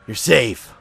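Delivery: play-acted rescuer's shout; calling out. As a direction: announce it loudly to someone you just saved. Calls out to the rescued civilian.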